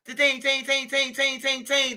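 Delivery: high, light tings of bullets on metal; ping each one like a bullet on a pole; ricochet sounds. Retold or imitated.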